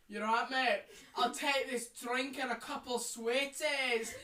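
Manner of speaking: loud put-on Scottish accent; broad mock Scottish, drawn out. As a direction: order in a hammed-up Scottish accent. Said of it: "Talk to people like you're Scottish".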